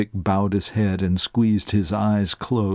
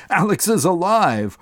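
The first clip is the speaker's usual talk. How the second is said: much higher, elated cry; joyful shout; 9 semitones higher, 3 dB louder than usual. A narrator's reading. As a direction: burst out with joy. Leaping with joy.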